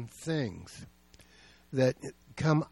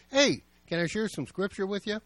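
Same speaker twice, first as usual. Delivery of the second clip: friendly call-in; 7 semitones higher than usual. Retold or imitated. Calling into rooms as visiting pastor.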